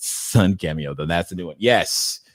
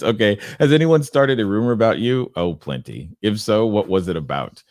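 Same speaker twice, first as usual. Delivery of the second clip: usual speaking voice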